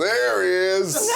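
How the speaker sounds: slow, laughing mock dad greeting; the dad greets his daughter's boyfriend, mock hearty; girlfriend's dad. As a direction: greet him like a hearty father. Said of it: "he goes".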